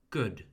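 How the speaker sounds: "Good" with a short English oo; short vowel. Shown as a demonstration. Teacher puts the sound in a word, short vowel in "Good". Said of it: "let's put it in a word".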